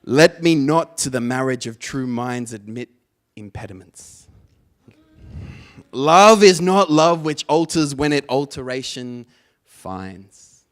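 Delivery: booming sonnet recitation; dramatic poetry recital. Shown as a demonstration. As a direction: declaim Shakespeare to the crowd. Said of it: "maybe some more grunting this one".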